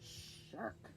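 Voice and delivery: put-on whispered shark warning to the kids. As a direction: whisper it like a scary secret.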